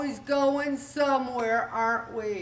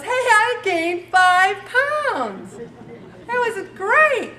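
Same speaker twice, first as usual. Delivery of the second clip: much higher, louder, very slow fake cheer; mock cheerful boast; 9 semitones higher, 5 dB louder, much slower than usual. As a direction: sell the bad news as a triumph. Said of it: "no one comes in and goes".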